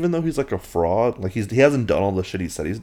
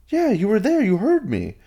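insistent Harry; 3 dB louder than usual. Retold or imitated. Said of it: "Harry answers".

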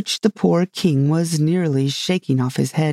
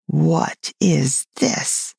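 slow, drawn-out disgust; much slower than usual. A narrator's reading. Unicorn eyeing Alice for the first time, disgusted. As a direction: draw each word out in mild revulsion. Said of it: "with an air of the deepest disgust".

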